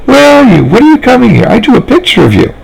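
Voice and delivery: put-on high, eager children's chatter; little girls thrilled to hear Mr Jacobs on the radio; excited little girls. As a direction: bubble over like small girls hearing a friend.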